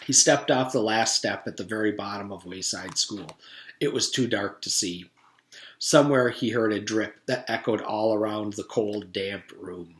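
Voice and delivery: ordinary delivery, nothing marked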